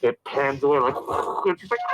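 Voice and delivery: normal talking voice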